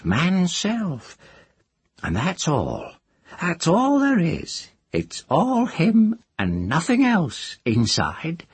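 put-on high, teasing old witch; a cackling village witch. A narrator's reading. Moss voiced.

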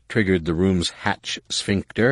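ordinary delivery

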